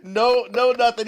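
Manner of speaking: ordinary delivery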